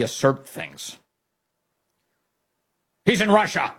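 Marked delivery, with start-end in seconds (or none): 3.1-3.7 high-pitched, emphatic false certainty; mock insistent reporter. Host acting the reporter asserting an unchecked claim, mock insistent. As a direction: blurt it out high as an overconfident reporter.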